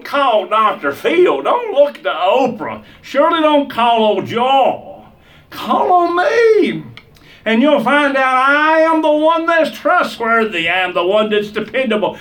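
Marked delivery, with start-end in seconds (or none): 5.5-10.7 preacher voicing the Lord, with a laughing, crying shout; the Lord calling out. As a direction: call out as God, pleading and crying. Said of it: "He said".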